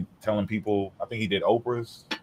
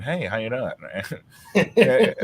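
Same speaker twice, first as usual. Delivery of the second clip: slick friendly greeting; 2 semitones higher, much slower than usual. Retold or imitated. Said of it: "dressed up in suits and".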